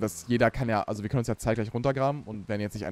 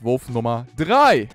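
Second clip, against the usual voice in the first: announcer voice; 10 dB louder, much slower than usual. Pretend. Dramatic announcement of throw.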